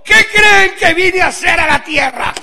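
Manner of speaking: high, loud, slow challenge; Jesus challenging disciples. Retold or imitated. Jesus questioning his frightened disciples, loud and high. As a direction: demand it of them like a prophet. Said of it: "les digo".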